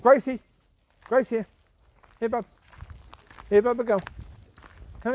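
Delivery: loud call across the park; loud call to a dog that is far off. Real, not acted. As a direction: shout the dog's name to bring her back. Calling the dog from afar.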